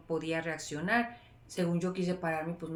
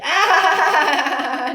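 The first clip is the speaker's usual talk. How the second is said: burst of laughter; 9 semitones higher, 14 dB louder than usual. Real, not acted. Laughs at her own spoiler.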